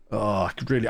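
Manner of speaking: ordinary talk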